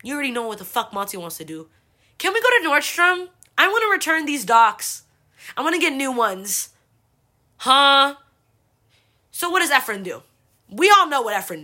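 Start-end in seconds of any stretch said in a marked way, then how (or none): 2.2-6.7 put-on high, loud pleading kid sister; whiny sister. Sister begging dad to go to Nordstrom, whiny and high. Sister whining about docs. 7.6-8.2 loud, high disbelieving huh; can't believe what you heard; incredulous huh. Retold or imitated. Teen reacting in disbelief to the Nordstrom detour, loud huh.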